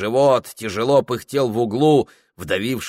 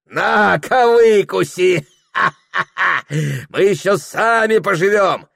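high, loud, laughing refusal; jeering shout; 11 semitones higher, 7 dB louder than usual. Acted. Host refusing the priest a donation, jeering loudly. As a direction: throw it back loudly with a laugh.

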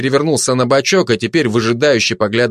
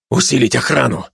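curt commander's order; understated military command; giving orders. Acted. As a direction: issue it as an order. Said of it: "распорядился спецназ".

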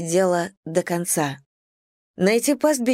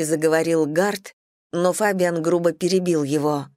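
usual speaking voice

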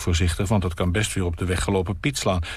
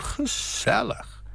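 slow, breathless high gezellig, softer; weary whispered gezellig; 4 dB quieter, much slower than usual. Acted. Nina murmuring gezellig to her guest, weary and slow. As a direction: sigh out gezellig tiredly. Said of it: "op een wat moede manier".

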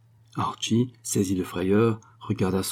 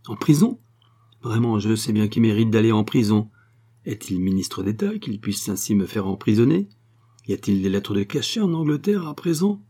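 indignant Sophie. Acted. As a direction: scoff at the threat of prison. Sophie indignant about prison. (Sophie indignée, voix haute)

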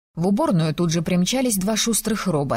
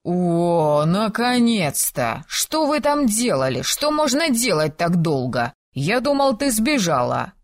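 impatient calling out; 5 semitones higher, slower than usual. Acted. Hungry Tamir whining, calling.

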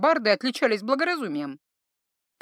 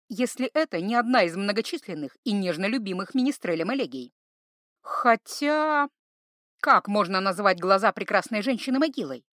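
ordinary delivery, nothing marked

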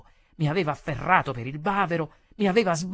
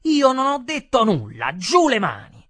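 loud, high-pitched angry protest; heated; 8 semitones higher, 10 dB louder than usual. A narrator's reading. Waiter pushing the customer away, heated. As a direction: protest hotly at full voice. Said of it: "Io mi scaldai e dissi".

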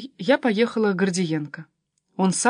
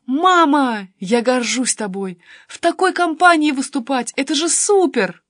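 loud, high gushing daughter; proud excitement; 5 semitones higher, 6 dB louder than usual. A narrator's reading. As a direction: gush with pride at your mum. Daughter thrilled by mother's company. (восторженно, с гордостью)

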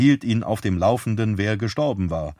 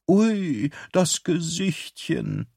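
high, slow cooing over a puppy; drawn-out baby talk to a dog; doting coo; 8 semitones higher, much slower than usual. Acted. Waitress coos over the dog.

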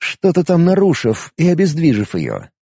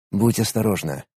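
tender; 4 semitones lower, 7 dB quieter than usual. Acted. Tender goodbye to Aren.

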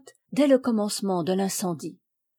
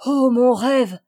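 usual speaking voice

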